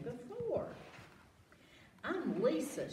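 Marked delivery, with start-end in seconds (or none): 2.0-2.9 put-on bright high little-girl voice. A narrator's reading. Lisa greeting the bear in the store, lightly voiced girl. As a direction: introduce yourself eagerly. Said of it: "she said".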